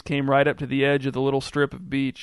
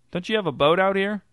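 higher-pitched, pleading question; mildly exasperated plea, pitched up; 6 semitones higher than usual. A narrator's reading. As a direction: press them for help, pitch raised. Swimmer pleads for a boat.

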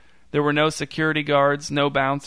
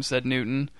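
plain reading voice

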